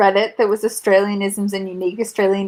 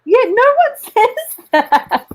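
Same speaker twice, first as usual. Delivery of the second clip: laughing; 11 semitones higher, much slower than usual. Real, not acted. Laughing through the line.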